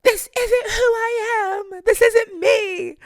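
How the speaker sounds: very high, loud, drawn-out cry; mock anguished wail. Founder rejecting the brand's look, hammed-up anguished wail.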